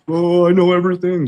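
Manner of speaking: high, loud, smug know-it-all voice; mocking his own ego. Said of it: "up here preaching and I'm getting my ego into it".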